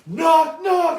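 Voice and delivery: high, loud crowd-prompting shout; loud, high wrestling-crowd chant; shouted knock knock. Retold or imitated. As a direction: call it out to a whole arena. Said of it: "looks at the crowd and goes".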